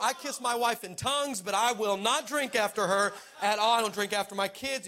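ordinary talk, nothing marked

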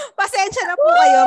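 laughing. Real, not acted. Laughing apology.

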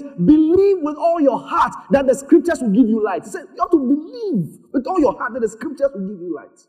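high, insistent sermon delivery; forceful preaching. Real, not acted. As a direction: proclaim the scripture to the congregation.

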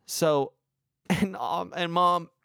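usual speaking voice

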